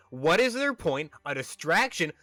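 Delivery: exasperated rant. Real, not acted. Frustrated rant about pointless scene.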